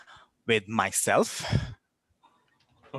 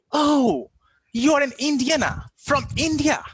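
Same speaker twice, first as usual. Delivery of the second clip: high-pitched, mock-excited exclamations; 10 semitones higher than usual. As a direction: imitate an over-eager know-it-all, pitched up. Mimics people excited about Indians.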